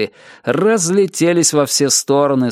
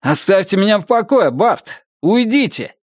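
higher-pitched, exasperated demand; exasperated outburst; 6 semitones higher than usual. A narrator's reading. Winnie orders Bart to leave him alone, exasperated. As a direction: push the pursuer away in a raised pitch. Winnie snaps during an acted quarrel.

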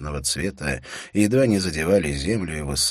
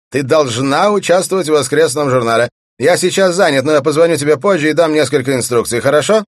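sustained high, loud yelling; impatient shout; 11 semitones higher, 12 dB louder than usual. A narrator's reading. Bellman giving orders over the horn, shouting impatiently. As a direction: shout orders briskly down the phone. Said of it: "прокричал он нетерпеливо".

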